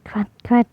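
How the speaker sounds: breathy whispered pig grunts. Acted. Narrator makes Peppa's pig grunt noise.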